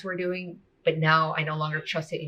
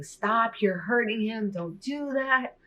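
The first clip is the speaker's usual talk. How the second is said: sobbing plea; 8 semitones higher, much slower than usual. Retold or imitated. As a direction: beg her to stop through tears, retold. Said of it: "I'm just sobbing and screaming".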